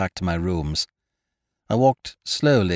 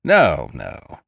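lower-pitched modest brush-off; modest disclaimer; 5 semitones lower, 4 dB louder than usual. A narrator's reading. Ukridge thanked by his hostess, modestly demurring. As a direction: wave the thanks away modestly. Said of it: "said Ukridge modestly".

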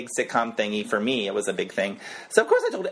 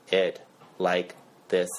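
monotone; 6 semitones lower, much slower than usual. Shown as a demonstration.

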